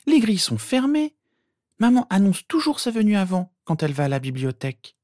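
put-on high-pitched girl, know-it-all; practical sister. A narrator's reading. Sister explaining why the plan fails, matter-of-fact. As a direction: list the obstacles in a high voice.

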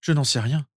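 plain reading voice

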